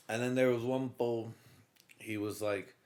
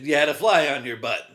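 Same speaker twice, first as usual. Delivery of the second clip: mock sergeant; 6 dB louder than usual. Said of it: "Yeah, that's it".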